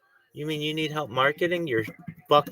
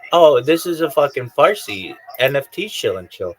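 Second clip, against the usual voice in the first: mock announcement; 8 dB louder than usual. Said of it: "I would say don't put it".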